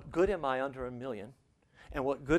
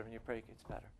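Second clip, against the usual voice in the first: much softer repeat, letting it sink in; a hushed, reflective echo; 3 semitones lower, 13 dB quieter, faster than usual. Acted. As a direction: drop the voice right down on the repeat.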